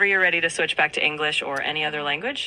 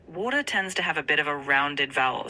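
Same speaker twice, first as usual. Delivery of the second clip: usual speaking voice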